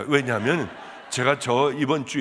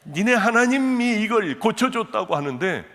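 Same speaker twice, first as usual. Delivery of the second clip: raised voice; 7 semitones higher, 6 dB louder than usual. Retold or imitated. Quoting old man emphatically.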